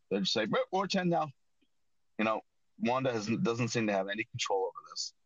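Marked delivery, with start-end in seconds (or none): 0.5-1.3 higher-pitched mock kid voice; imitating kids. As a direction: lightly mimic children announcing their news. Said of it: "The kids basically age themselves up".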